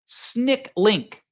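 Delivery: normal talking voice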